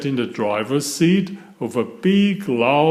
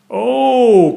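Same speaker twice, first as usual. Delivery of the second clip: high, loud, drawn "oh" of wonder; excited gasp; 11 semitones higher, 8 dB louder than usual. A narrator's reading. Peppa and George hear of a surprise adventure, excited gasp. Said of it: "gasped Peppa and George".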